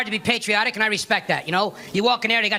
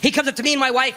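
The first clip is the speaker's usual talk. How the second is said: ordinary talk, nothing marked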